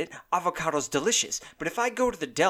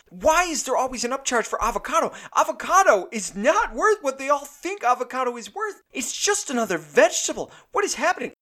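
much higher, louder exasperated outburst; higher and louder, building exasperation; comic rant; 9 semitones higher, 4 dB louder than usual. Acted. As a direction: blow up in mock outrage. Ranting about avocado upcharges.

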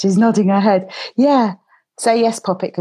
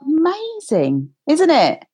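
praise; 7 semitones higher, slower than usual. Real, not acted. Host praises child's progress.

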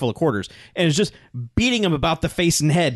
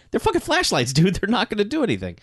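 high mocking voice; 8 semitones higher than usual.

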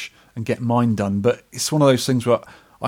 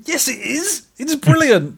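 much higher, squeaky mock insistence; mock squeaky protest; slower than usual. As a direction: squeak back in playful protest. Mock arguing back, high squeak.

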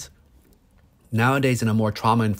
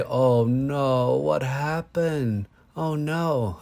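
higher, slow soothing sympathy; 4 semitones higher, much slower than usual. Shown as a demonstration. Parent comforting toddler over broken Lego, soothing. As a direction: soothe a crying toddler with your voice. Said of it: "I try to give him soothing energy in my voice".

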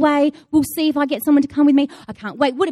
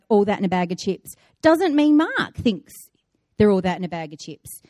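usual speaking voice

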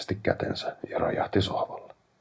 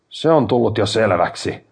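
louder, higher, irritated retort; 4 semitones higher, 9 dB louder than usual. A narrator's reading. A man fed up with his obsessed brother's demands, irritated. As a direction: cut in with raised-voice irritation. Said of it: "sanoin ärtyneenä".

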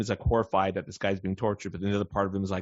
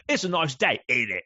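higher-pitched put-on British accent; mock British accent; 3 semitones higher than usual. Co-host imitating Brits asking a question, mock British accent. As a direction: caricature a Brit making small talk. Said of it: "the British do".

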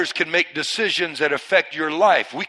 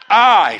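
"I" punched loud and held alone; loud, isolated "I"; emphatic I; 4 semitones higher, 8 dB louder than usual. Shown as a demonstration. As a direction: hit "I" hard for the congregation to repeat. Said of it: "Put some emphasis on it".

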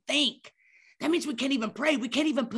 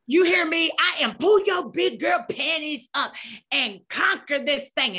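mock pep-talk shout; 6 semitones higher, 4 dB louder than usual.

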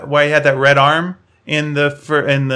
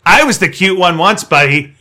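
loud, higher, bitter rant; jealous droid; 4 semitones higher, 5 dB louder than usual. Acted. Voicing jealous R2-D2.

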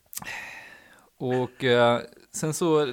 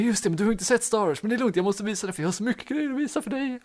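high, excited show-off voice; mock eager guy; 9 semitones higher than usual. Mimicking the brother's friend gushing to her, high and eager. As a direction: ham up his gushing. Mocking the guy's eager chatter.

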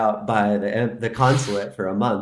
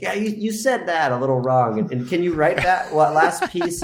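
mock French examiner; 7 semitones higher than usual. Mimicking strict French examiner.

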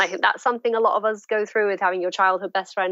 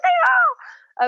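very high, giddy squeak; starstruck squeal; 3 dB louder than usual. Retold or imitated. Fan answering her idol, mock starstruck squeal.

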